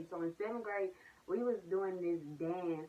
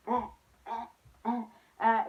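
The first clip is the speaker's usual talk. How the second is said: higher, rising louder ah sounds; improvised dance grunts; 4 semitones higher, 4 dB louder than usual. Retold or imitated. Dancer covering a missed move in front of the gym, loud ahs. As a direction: punch out ahs to cover the mistake. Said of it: "I just started going".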